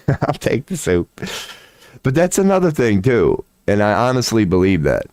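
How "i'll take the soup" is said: mock polite refusal. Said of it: "I'd be the guy going".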